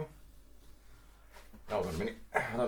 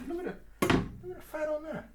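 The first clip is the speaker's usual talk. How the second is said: delighted squeal. Real, not acted. Delighted at fatty joint.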